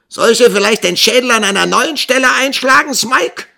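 very high, piercing threat; shrill yelling. A narrator's reading. Schoolmaster's wife threatening the missing boy, shrill yelling. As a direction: shriek the threat full out. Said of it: "gellte die liebenswürdige Schulmeistersgattin".

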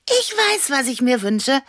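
very high, loud burst; eager announcement. A narrator's reading. Annabella tells Leo she has a wish, excited. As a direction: blurt it out, pitched very high.

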